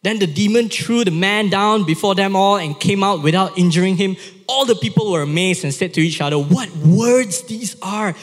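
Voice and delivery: loud, high-pitched Bible reading; dramatic scripture reading. A narrator's reading. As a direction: read it out with amazement and force. Reads Bible passage aloud with drama.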